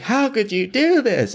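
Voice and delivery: high-pitched mimicked accusation; mock outrage.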